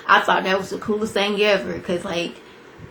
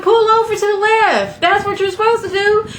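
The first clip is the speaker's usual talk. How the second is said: panicked order; 12 semitones higher than usual. Retold or imitated. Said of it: "So I was like".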